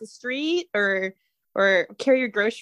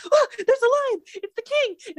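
high-pitched gasp of wonder; excited Hava; 11 semitones higher than usual. Acted. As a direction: marvel in a much higher voice. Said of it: "So she's gonna be like".

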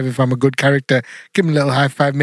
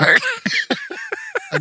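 comically high, slow squeak; squeaky high voice; 4 dB quieter than usual. Pretend. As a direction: squeak it out like a helium toy. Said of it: "Someone take the foam off".

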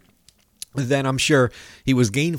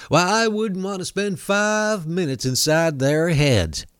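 Southern mugwump voice; 3 semitones higher than usual. Retold or imitated. Radio host mocking an official in a Southern accent. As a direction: put on a Southern preacher's accent to mock him. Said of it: "I'm gonna do a Southern mugwump voice".

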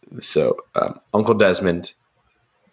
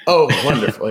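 lightly voiced smiling uncle, higher; a hint of a happily oblivious uncle; Uncle Desmond; 6 semitones higher, 3 dB louder than usual. Uncle told who the father is, mildly delighted and higher. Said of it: "always there and always smiling".

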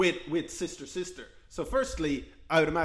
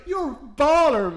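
mock impressed kids; 10 semitones higher, 5 dB louder, slower than usual. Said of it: "they're like".

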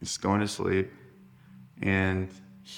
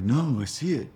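higher, insistent brother imitation; brother's old voice; 6 semitones higher than usual. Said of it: "old voice, his old voice".

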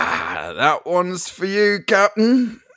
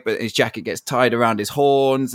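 ordinary talk

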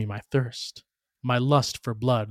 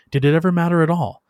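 rising, louder and faster; urgent louder question; 5 semitones higher, 5 dB louder, faster than usual. Acted. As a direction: push it out fast and loud. Boy doubts as creature dies.

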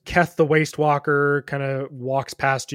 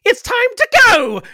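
very high, loud squeaky cry; little brass goblin; 12 dB louder than usual. Acted. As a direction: shriek it like a tiny clockwork goblin. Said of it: "And the little brass man says".

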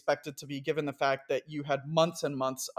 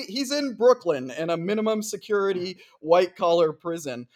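usual speaking voice

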